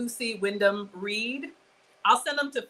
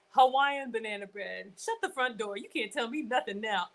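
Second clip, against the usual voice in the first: usual speaking voice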